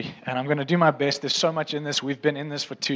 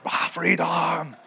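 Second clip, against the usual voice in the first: mock battle cry; much slower than usual. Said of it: "I'm picturing Braveheart".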